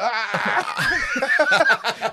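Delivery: high, loud, laughing "Ah!"; retelling the couch breaking with a mock yelp; startled yelp. Couch breaks under him.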